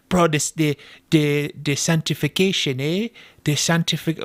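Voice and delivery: stumbling attempt at mimicking a Jamaican accent; mock Jamaican accent. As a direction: fumble through the line in a put-on Jamaican lilt. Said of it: "that sounded Jamaican".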